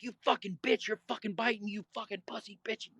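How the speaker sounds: high mock yell, losing volume as it goes; retold screaming. Pinned fighter cursing the man biting them, retold screaming. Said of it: "The whole time I'm screaming".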